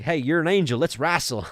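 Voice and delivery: put-on Southern accent. Imitating Brother James challenging an angel to wrestle, Southern accent. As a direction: call it out in a good old Southern accent. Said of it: "this good old, uh, Southern accent".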